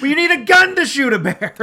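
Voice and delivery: high, loud amused objection; mock protest. Co-host arguing the bear bit, loud mock protest.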